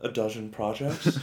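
put-on light Sean Connery accent, rising; a touch of Sean Connery. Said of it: "wait, what accent is that?".

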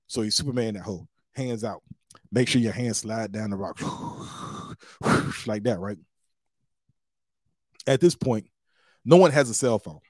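ordinary talk, nothing marked

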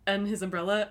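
normal talking voice

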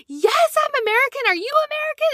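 high-pitched thrilled squeal of greeting; excited. Retold or imitated. Lonely traveller greeting fellow Americans abroad, excited squeal. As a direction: gush it way up high, overjoyed.

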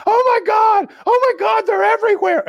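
retold high-pitched scream of alarm; panicked shout. Teammate yelling up to his friends from the bottom of the rope, panicked shout.

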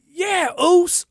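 high, loud excited greeting; light imitation of an excited friend; excited Popo.

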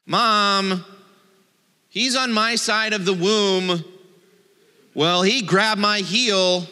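squabbling twin whining from the back seat; whiny kid in the back seat. Pretend. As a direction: tattle to mom like a bickering sibling. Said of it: "like the back seat of a car".

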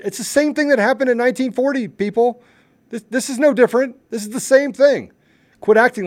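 high, loud, insistent outburst; heated shout. Real, not acted. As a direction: raise your voice in outrage. Ranting comparison to nineteen forty.

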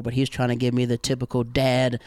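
ordinary talk, nothing marked

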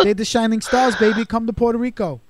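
put-on light Brooklyn accent, high; lightly put-on Brooklyn accent. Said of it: "I did a Brooklyn accent".

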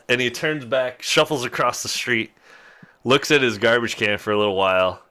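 normal talking voice

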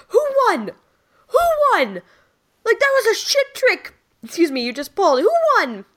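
put-on high-pitched whining kid brother asking who won; whiny little brother. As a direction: squeal it like a sore loser sibling.

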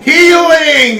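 correcting him with a loud shout. Acted. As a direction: yell Healing! at him.

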